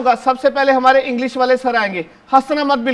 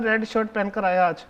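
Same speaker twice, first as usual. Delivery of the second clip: ordinary talk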